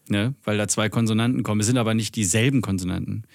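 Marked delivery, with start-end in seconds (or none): none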